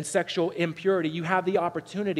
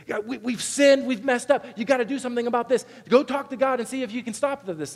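higher, urgent plea to Moses; pleading people; 6 semitones higher than usual. Retold or imitated. Israelites begging Moses to stop the snakes, high urgent plea. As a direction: voice the panicked crowd begging.

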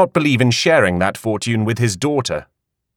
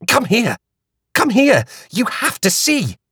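high, eager calls; excited beckoning; 13 semitones higher than usual. A narrator's reading. Flora urging Hattie to come see. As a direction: wave her over urgently. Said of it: "gestured wildly for Hattie".

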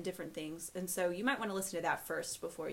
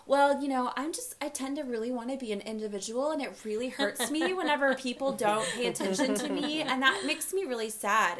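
hammed-up, higher and louder party confession; mock-earnest oversharing, voice pitched up and pushed; earnest oversharer; 6 semitones higher, 6 dB louder than usual. Imagined guest confessing feelings at a party, hammed-up earnest.

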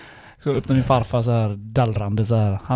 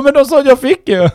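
much higher, louder pleading; whiny excuse; 14 semitones higher, 9 dB louder than usual. Retold or imitated. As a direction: defend yourself like a caught kid. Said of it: "Jag bara".

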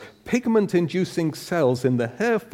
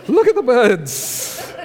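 1 excited exclamation; 11 semitones higher, 5 dB louder, slower than usual. Acted. Exclaiming Jesus's call.

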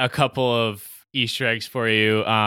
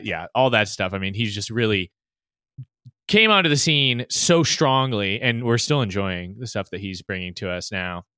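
normal talking voice

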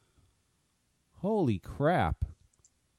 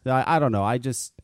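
ordinary talk, nothing marked

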